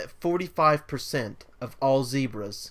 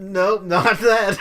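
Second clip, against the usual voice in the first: shouting; 5 semitones higher, 7 dB louder, slower than usual. Real, not acted. Cuts off offensive remark.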